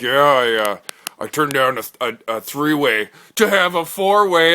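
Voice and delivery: one guy boasting to the table, loud and slow; bragging bro. Acted. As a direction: brag it like a proud meathead.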